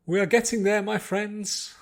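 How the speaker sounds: rally the choir with joy; joyous. Acted. Choir leader cheering on his singers, joyously. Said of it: "said joyously".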